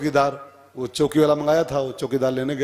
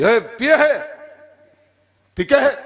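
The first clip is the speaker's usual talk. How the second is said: loud, high barked question; hammed-up policeman's shout; police challenge; 10 semitones higher, 6 dB louder, slower than usual. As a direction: pounce on the drunkard with a sharp shout. Police stop a drunk man.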